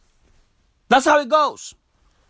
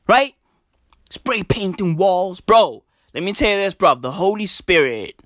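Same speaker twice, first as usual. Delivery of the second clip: usual speaking voice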